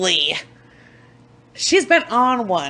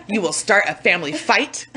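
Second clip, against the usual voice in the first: usual speaking voice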